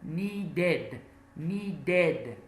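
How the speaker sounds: "Needed" in two clear syllables, nee-did; ED as separate syllable. Shown as a demonstration. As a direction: say "Needed" with a full "id" ending. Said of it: "is pronounced as a separate syllable".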